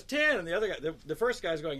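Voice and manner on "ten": high loud shout; bidder yelling. Retold or imitated. Bidder shouting a higher bid, loud and high. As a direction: bark out the bid. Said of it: "Another one goes".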